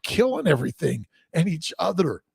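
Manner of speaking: ordinary talk, nothing marked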